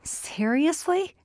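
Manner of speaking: high, slow disbelieving question; stunned and delighted disbelief; surprised. Acted. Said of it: "she asked, surprised".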